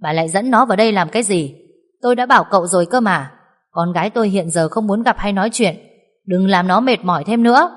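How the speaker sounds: loud, fast angry father's bark; scolding shout. Acted. Father-in-law yells at wife for bringing son-in-law in, loud and angry. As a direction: snap at them hard and fast. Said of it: "quát".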